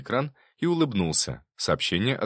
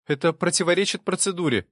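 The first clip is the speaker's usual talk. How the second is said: high-pitched objection; protesting; 11 semitones higher, 3 dB louder than usual. A narrator's reading. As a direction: object on procedure, pitched well up. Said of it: "запротестовала Кира". (протестующе, высоким голосом)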